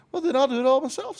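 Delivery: high, fast hen voice; fine, I'll do it myself, in a huff; huffy hen. Acted. Hen refuses lazy friends.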